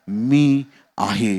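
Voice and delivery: very slow, drawn-out words; solemn divine declaration. Acted. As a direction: speak it as God naming himself, stretched out. God gives his name.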